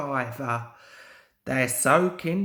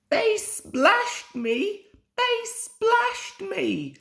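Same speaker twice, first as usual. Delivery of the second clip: very high, slow, indignant engine voice; hissed angrily; slower than usual. A narrator's reading. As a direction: hiss it out, outraged. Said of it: "Bert hissed".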